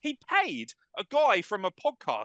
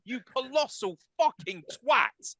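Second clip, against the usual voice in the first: louder, higher mock outburst; mock rant; 4 semitones higher, 10 dB louder than usual. Host starting a rant at the owner, mock angry. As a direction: launch into a staged rant. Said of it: "wants a fucking rant".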